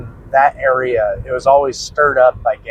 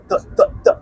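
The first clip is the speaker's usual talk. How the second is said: imitated footsteps; much faster than usual. Imitating running shoe noise.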